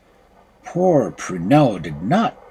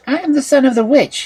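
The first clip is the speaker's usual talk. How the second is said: youth replying; 13 semitones higher, 5 dB louder, faster than usual. A narrator's reading. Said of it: "he replied".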